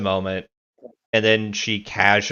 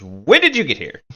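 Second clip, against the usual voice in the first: jumpy, startled blurt; startled question; 6 semitones higher, 6 dB louder than usual. Acted. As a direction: caught off guard, blurt it out. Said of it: "Rena jumps, like, ten feet in the air".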